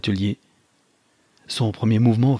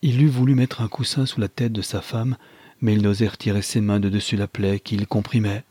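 ordinary delivery

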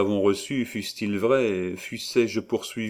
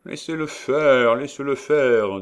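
loud, high sepulchral witch voice; 6 semitones higher, 6 dB louder than usual. A narrator's reading. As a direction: intone it like a ghost. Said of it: "dit une voix sépulcrale".

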